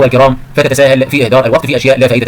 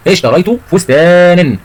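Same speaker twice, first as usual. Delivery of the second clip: slow, higher, long-drawn فستانا; slow emphatic repeat; 5 semitones higher, much slower than usual. Shown as a demonstration. Teacher repeats the example before asking about tanween, slow and emphatic. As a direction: stretch out فستانا for the class.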